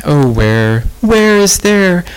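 a frightened tearful lament; crying out. A narrator's reading. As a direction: wail the words in distress. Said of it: "And Rose began to cry".

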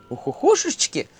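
high owlish exclamation; owl hoot. A narrator's reading. Owl hoots.

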